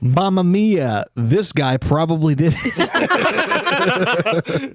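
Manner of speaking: slow, laughing cartoon Italian; laughing through a bad Italian accent; mock Italian accent. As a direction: drag it out in a hammed-up Italian accent. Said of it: "Italian accent".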